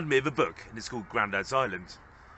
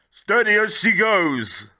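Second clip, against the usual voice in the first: slow, loud and higher, a grandad booming at the wheel; booming captain; 10 semitones higher, 10 dB louder, slower than usual. Acted. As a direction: boom it out like a proud sea captain. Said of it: "Grandad boomed".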